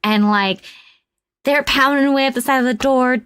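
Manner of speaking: usual speaking voice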